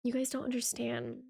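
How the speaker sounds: lightly mocking pretentious artist voice, hushed at the end; snooty artist. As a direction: talk down to them, fading into a slow hushed reverence. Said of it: "We're basically told".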